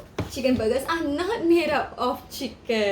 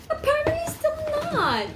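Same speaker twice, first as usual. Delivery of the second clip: mock dismay. Teacher reacting in mock dismay.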